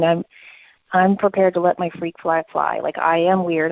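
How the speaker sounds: ordinary talk, nothing marked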